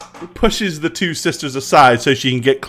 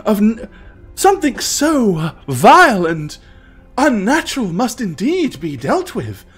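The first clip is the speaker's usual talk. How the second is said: put-on a nervous young temple sister; young priestess voice; 4 semitones lower than usual.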